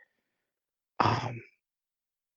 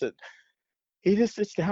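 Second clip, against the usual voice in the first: ordinary talk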